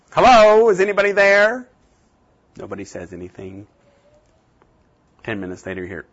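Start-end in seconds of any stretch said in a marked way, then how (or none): 0.1-1.7 called out high and loud toward the door; calling out. Retold or imitated. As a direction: call out into the yard to find whoever is there. Calling out to unseen visitor.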